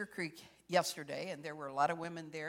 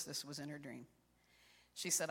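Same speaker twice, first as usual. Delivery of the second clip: normal talking voice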